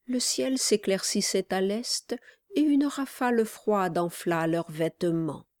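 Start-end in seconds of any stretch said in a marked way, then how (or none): none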